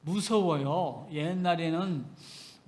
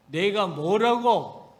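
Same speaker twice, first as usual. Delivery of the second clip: louder, slow, drawn-out reproach; stern rebuke; 4 semitones higher, 7 dB louder, slower than usual. Retold or imitated. Preacher voicing God rebuking Miriam, slow and loud. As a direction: draw it out like God's judgment.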